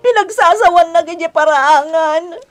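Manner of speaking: speaking through sobs; tearful, voice catching as she cries; wife crying to her husband about his mother. Acted. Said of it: "apay ngagsangsangit ka?".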